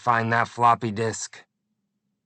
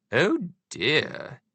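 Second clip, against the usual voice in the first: Queen's voice; 4 semitones higher than usual. A narrator's reading. Queen worried.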